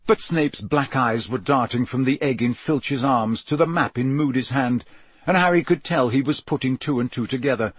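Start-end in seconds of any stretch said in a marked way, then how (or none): none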